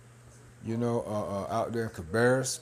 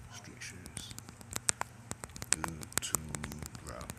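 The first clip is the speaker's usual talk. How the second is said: whispering; 8 semitones lower, 16 dB quieter, slower than usual. Real, not acted. Reading under breath at start.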